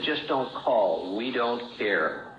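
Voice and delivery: spelled out very slowly and coldly; each word laid down slow, closing the door; cold, slow refusal. Retold or imitated. Family telling the jailed drunk not to call, slow and cold. Loved ones refusing his calls.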